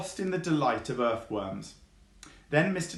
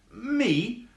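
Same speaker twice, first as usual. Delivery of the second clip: high, surprised fox; 12 semitones higher, 4 dB louder than usual. Acted. Fox protests innocence.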